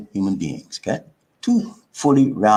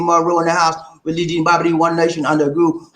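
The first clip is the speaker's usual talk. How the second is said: normal talking voice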